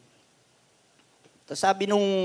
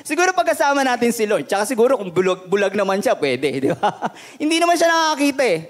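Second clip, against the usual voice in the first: joking through laughter, higher and louder; laughing; 4 semitones higher, 3 dB louder than usual. Real, not acted. Preacher joking to congregation about a blind Paul, laughing. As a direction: laugh your way through the joke.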